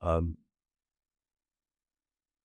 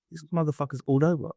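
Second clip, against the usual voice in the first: usual speaking voice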